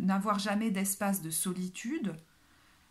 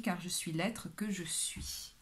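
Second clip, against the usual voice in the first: whispering; 3 semitones lower, 7 dB quieter than usual. Acted. Reading an affirmation card.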